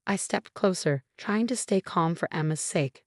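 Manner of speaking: plain reading voice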